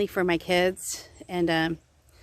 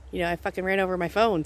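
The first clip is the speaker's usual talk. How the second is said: usual speaking voice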